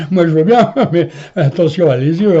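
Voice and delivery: laughing warning. Retold or imitated. Telling his friend to beware, laughing. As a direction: warn him through laughter.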